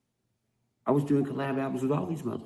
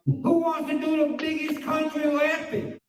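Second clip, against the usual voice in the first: much higher put-on voice, hyping it up; mock announcer; 13 semitones higher than usual. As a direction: sneer like a cheesy promo host. Mocking rivals' album pitch.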